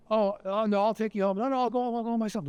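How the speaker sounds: high, lightly voiced little girl; flustered little girl. Little girl puts off the Nazi soldier, flustered refusal.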